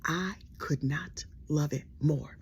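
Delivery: slow hushed delight; gleeful whisper. Real, not acted. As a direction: whisper it slowly. Confiding to audience.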